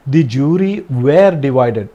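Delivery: very slow, word by word; slow dictation. Shown as a demonstration. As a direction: read it out slowly for the class to write. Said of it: "divided ആയപ്പോൾ". (വളരെ പതുക്കെ, വാക്കോരോന്നായി)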